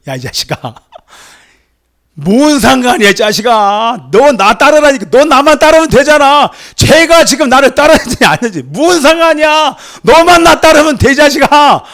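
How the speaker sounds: a pretend scolding that the preacher says Jesus never gave; mock scolding Jesus. Preacher jokingly voices Jesus scolding him, mock angry and loud. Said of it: "이렇게 주님이 말씀하지 않으시고".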